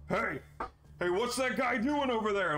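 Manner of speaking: high alarmed call across the alley; guard shouting. Acted. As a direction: yell it out to the other guard.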